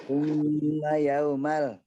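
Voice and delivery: "Tsumma" with the mm drawn out slowly; long mim. Shown as a demonstration. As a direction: hold the mm in "Tsumma". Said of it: "summanya panjang".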